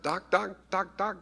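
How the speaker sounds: put-on high baby-talk calling; imitating a baby calling Doc, high and sing-song; baby calling the dog. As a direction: call the dog like a toddler. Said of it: "she's like".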